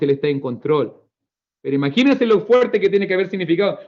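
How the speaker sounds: ordinary delivery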